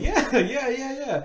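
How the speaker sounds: high laughing yeahs; laughing along. Retold or imitated. Dad humoring the accented man, high laughing yeahs. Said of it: "He's going".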